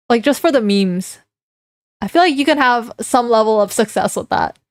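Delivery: ordinary talk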